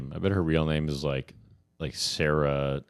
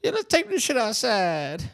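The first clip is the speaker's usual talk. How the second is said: very high, mocking caricature voice; hammed-up street-talk put-on of a politician; Jasmine Crockett; 3 dB louder than usual. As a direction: shoot the voice way up high, exaggerated. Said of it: "when she goes out there, she's like".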